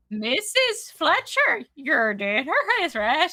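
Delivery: high, slow, hammed-up stage-Irish accent; mock a stiff TV Irish accent, drawn out; bad Irish accent. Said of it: "taught that person an Irish accent".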